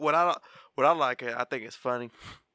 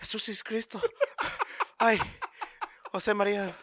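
mock startled; 9 semitones higher than usual. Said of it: "like, like".

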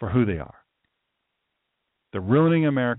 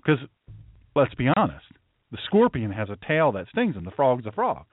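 ordinary talk

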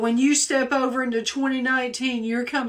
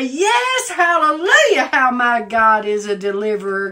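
exultant comeback; 6 dB louder than usual. Acted. Believer answering the devil's accusation, exultant cry. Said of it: "and you say".

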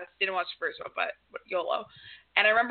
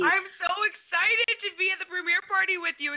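excited shout; 7 semitones higher than usual. Real, not acted. Guest shouts excitement about party.

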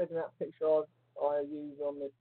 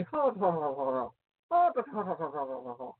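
put-on childlike laughing; 5 semitones higher than usual. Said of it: "It's something like a child".

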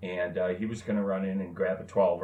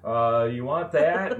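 calling out; 7 semitones higher, 4 dB louder than usual. Retold or imitated. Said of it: "he says".

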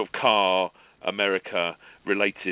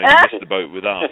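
a much higher, much louder mocking quip, no accent; 11 semitones higher, 12 dB louder than usual. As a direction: toss it off as a loud sardonic jab. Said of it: "he said in his quippy, sardonic way".

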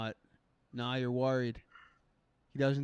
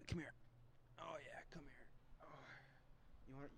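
whispering; 26 dB quieter than usual. Real, not acted. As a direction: whisper gently, coaxing. Whispering to the cat.

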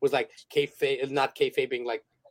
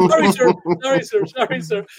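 mock apology; 5 semitones higher, 7 dB louder than usual. Mock apology to scolding Necro.

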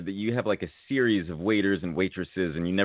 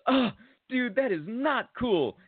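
high, slow mock-offended dude; the waiter acting hurt; 14 semitones higher, slower than usual. Waiter pretends to be offended by the Grindr answer, high and slow.

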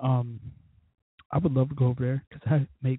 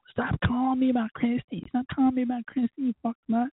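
high-pitched mock complaint; mocking impression; 9 semitones higher than usual. D B fed up with hearing about Christy, high mocking whine. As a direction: whine it high, imitating an annoyed friend. Mocking D B's annoyed reply.

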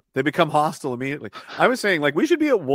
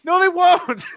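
much higher and louder, half laughing; incredulous retort; 8 dB louder, slower than usual. Real, not acted. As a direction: shoot the idea down, loud and amused. Laughing rebuttal of the mock claim.

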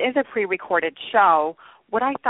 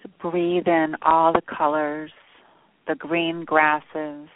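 sleep story narrator. Acted. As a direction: ease them into calm with long pauses. Leads a meditation.

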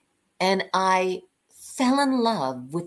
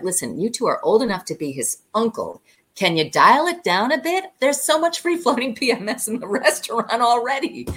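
playfully telling them off, rising higher and louder; lively retold ticking-off; mock scolding; 4 semitones higher, 5 dB louder than usual. Waitress tells two diners to dial it down, mock scolding.

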